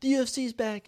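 calling mom late at night, retelling the excited announcement; excited news to mom. Retold or imitated. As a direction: burst out the news like a thrilled kid. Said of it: "I was like".

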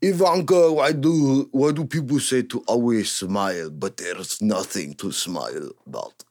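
a gloomy Russian TikTok girl in a hint of Russian accent; mock Russian accent. Imitating Russian girls on TikTok, in a mock Russian accent. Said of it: "they're like".

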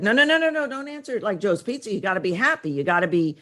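mock manager, high and hurried correction; scolding manager. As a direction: burst in high, coaching forced cheer. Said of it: "the manager said".

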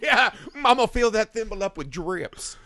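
hammed-up lusty belle; mock Southern belle. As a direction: purr it like a swooning belle. Mocking swooning Wendy.

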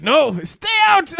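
high, slow mock refusal; mock Quebecer. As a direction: squeak it out slowly. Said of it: "They would be like".

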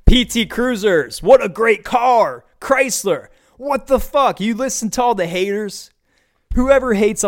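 overexcited pitch for the P T Cruiser; hyped car praise. Real, not acted. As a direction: sell it like a fanboy. Mock car enthusiasm rant.

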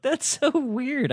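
high, slow mock bewilderment; retold amazement. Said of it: "And I was like".

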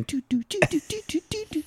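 high soft doo doo riff; fading, high organ hum; organ imitation. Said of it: "Shitty eighties organ".

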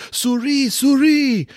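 high, loud imitation of an excited cry; mimicked Frenchman's excited shout; excited calling out. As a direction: call it out high and loud, mimicking a sudden sighting. Geoffroy's sudden excited call, acted.

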